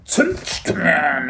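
high, loud creature voice with rounded vowels; eager creature. Acted. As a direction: repeat the word like a little creature.

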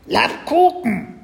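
put-on very high, loud, drawn-out baby-dragon cry; squeaky, slow creature voice; little creature calling. A narrator's reading. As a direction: squawk it like a tiny hungry beast. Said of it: "rief das kleine Wesen".

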